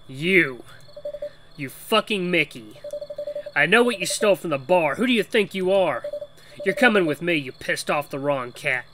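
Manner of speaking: hammed-up angry cat; angry confrontation. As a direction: confront a thief with rising force. Voices angry cat confronting the player.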